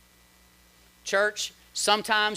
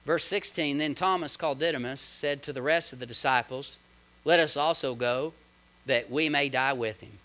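softer, lower Bible reading; scripture reading; 6 semitones lower, 5 dB quieter than usual. Acted. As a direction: read the verse quietly. Reads scripture.

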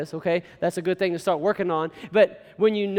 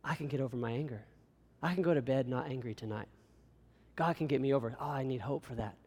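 soft, lower, hushed self-assurance; hopeful reading; 6 semitones lower, 10 dB quieter than usual. Shown as a demonstration. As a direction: quietly tell yourself there is hope. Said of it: "how about this".

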